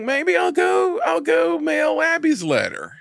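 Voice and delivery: very high, put-on voice; exaggerated high-voiced character quote; imitating a movie heroine. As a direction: pitch it right up in a mock-feminine voice. Acting out Jenny's timid resolve.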